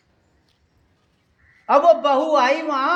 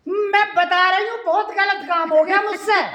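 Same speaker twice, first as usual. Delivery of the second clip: put-on higher, earnest young wife; wife's voice; 6 semitones higher than usual. Wife confessing her mishap to her husband in a higher voice. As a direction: pitch up into the embarrassed wife.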